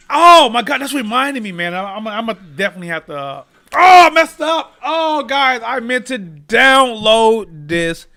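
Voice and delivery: sudden loud realization; oh no, high and loud; surprised. Real, not acted. Realizes he forgot recording.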